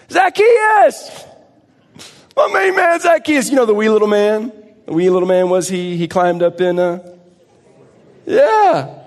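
high, loud, joyful calling out; excited shout. Acted. Pastor greets Zacchaeus in heaven, loud and joyful. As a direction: hail him like a long-lost buddy.

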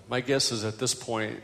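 ordinary talk, nothing marked

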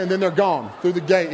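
normal talking voice

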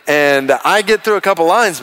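ordinary talk

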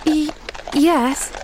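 hesitant, stammered admission; reluctant yes with a sigh in it. A narrator's reading. Girl answers the doctor about her aunt, sighing. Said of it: "sighed Pollyanna".

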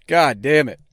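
ordinary delivery

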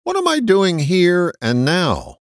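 "here" pushed up high and held; stress on here. Shown as a demonstration. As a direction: lean hard on "here and now". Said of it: "I put the accent on the word here".

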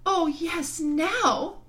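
high, loud eager cry; high-pitched, jubilant little girl; excited girl. A narrator's reading. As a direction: burst out with delight. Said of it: "jumping up and clapping her hands".

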